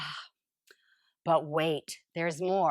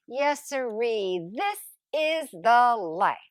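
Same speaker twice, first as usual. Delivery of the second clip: ordinary delivery, nothing marked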